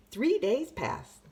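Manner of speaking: usual speaking voice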